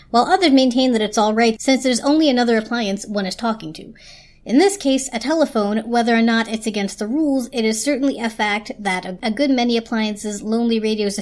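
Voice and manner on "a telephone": ordinary delivery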